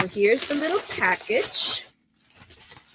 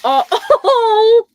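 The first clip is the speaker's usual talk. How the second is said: delighted laugh; 11 semitones higher, 10 dB louder, much slower than usual. Real, not acted. Opening a gift box.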